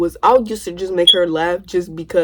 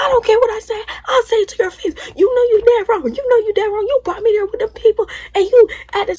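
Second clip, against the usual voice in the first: mock screaming; 14 semitones higher than usual. Narrator screaming back at her cousin, high mock yell. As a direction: yell it straight to her face. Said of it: "And I was like".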